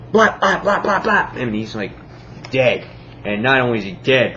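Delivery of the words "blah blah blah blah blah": high-pitched mock gunfire noises; imitated gunshots. Retelling the cowboy shooting the president, mock gunfire. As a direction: fire off high verbal gunshots. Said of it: "he just shoots the, the president".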